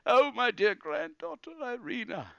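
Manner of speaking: loud, high theatrical wailing; hammed-up old man's sobbing lament; crying uncontrollably. Grandfather mourning his stolen granddaughter, crying uncontrollably. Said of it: "begins to cry uncontrollably".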